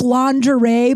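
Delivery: ordinary talk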